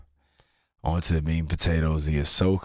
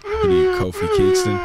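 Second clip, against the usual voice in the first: high, cracking laugh through words; 4 dB louder than usual. Real, not acted. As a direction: crack up mid-question. Cracking up at Jamaican accent.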